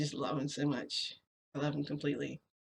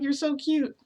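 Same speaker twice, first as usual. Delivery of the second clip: doting coo; 10 semitones higher, 7 dB louder than usual. Retold or imitated. Retells his doting remark.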